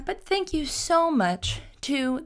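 slow, high sponsor-read voice; hamming up a sponsor thank-you; mock ad read. Thanking podcast sponsors in a slow, high mock ad-read voice. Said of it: "the shortest ad read I've ever done".

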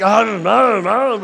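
loud, high repeated "um"; imitated baby food grunts. Baby running round after chocolate, imitated food grunts. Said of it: "he does this thing when he wants to eat".

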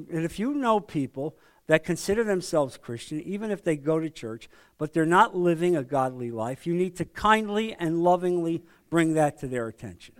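ordinary talk

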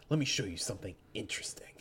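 fast conspiratorial whisper. Acted. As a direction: hurry it out under your breath. Fighter teases a secret.

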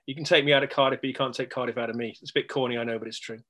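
usual speaking voice

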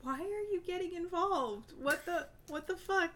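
baffled. Retold or imitated. Acted baffled reaction to stranger.

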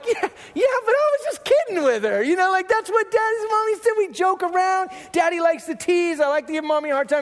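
high, fast-rising sheepish pleading; lightly mocking dad caught out, pitched high; defensive excuse. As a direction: squeak out a guilty excuse to a child. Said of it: "And I was like".

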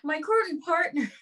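slow put-on Irish letter reading; playful Irish lilt; Irish accent. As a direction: read it out in mock Irish. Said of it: "Yeah, do an Irish one".

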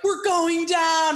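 hammed-up sinking-ship panic; mock panic. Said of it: "a guy on the Titanic being like".

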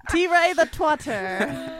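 slow, laughing tease; teasing a co-host amid laughter. Real, not acted. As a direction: drag it out through laughter.

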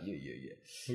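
low, soft, dismissive yeahs; offhand brush-off. Retold or imitated. Imitating teenage Jan brushing off the others, low and quiet. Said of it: "he was like".

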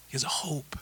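soft, whispered promise to the listeners; hushed assurance. Real, not acted. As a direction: drop to a near-whisper.

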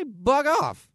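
high, loud snap of bug off; mock brush-off shout. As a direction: throw it back at him sharply. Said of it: "You'd say".

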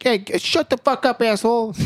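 New Yorker yell. Retold or imitated. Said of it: "New Yorker guy's like".

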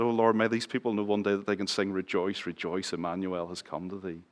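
hushed prayer. Retold or imitated. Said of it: "I was praying at the West Royal".

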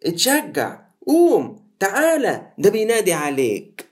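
high, hurried coaxing; the crowd now hurrying him along; high excited encouragement; eager urging. Acted. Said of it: "قالوا له".

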